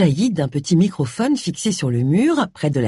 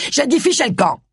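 higher, exasperated dismissal; angry order; 7 semitones higher than usual. Acted. Pastor throwing out the prying visitors, angrily. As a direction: order them out, losing patience.

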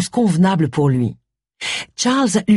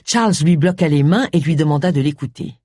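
usual speaking voice

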